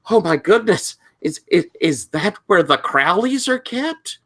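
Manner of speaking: put-on high, awed teenaged student; Timmy. Student asking the librarian about rare books, awed teen voice. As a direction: stammer in high, eager wonder. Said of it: "you see Timmy go".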